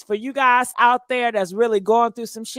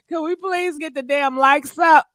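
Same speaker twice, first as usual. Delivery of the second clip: ordinary talk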